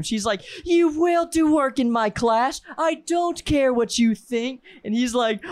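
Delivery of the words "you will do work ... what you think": high, slow, strained imitation of scolding; hammed-up furious teacher, drawing out each word; mock berating. Teacher berates student hiding under desk, mock scolding. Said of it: "absolutely berating him".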